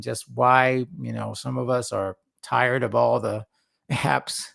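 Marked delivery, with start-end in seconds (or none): none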